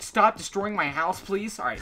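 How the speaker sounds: ordinary talk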